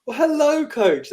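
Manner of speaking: high, loud greeting in a put-on British accent. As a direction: greet him like a cheerful Londoner in a British accent. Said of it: "That was a British accent".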